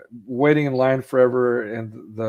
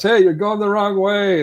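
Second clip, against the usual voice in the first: high, loud mock call; imitated warning yell, pitched up; shouted warning; 10 semitones higher, 7 dB louder than usual. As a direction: holler a warning at them. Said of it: "people trying to warn us".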